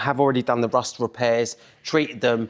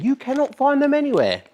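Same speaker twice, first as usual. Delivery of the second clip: high emphatic; 4 dB louder than usual. Real, not acted. Emphatic claim about rare parts.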